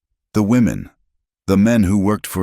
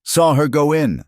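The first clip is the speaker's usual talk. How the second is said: higher, louder townsman calling; crying out; 11 semitones higher, 4 dB louder than usual. A narrator's reading. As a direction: call it out over the crowd. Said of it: "another voice cried".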